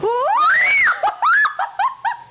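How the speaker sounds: high squealing laugh; panicked shriek of laughter. Real, not acted. Bird flies at her.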